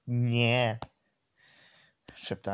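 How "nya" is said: put-on drawn-out cat-girl "nya". The tiger girl answers, a cat-like nya. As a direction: meow it as "nya". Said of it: "Immer wenn sie N Y A sagt".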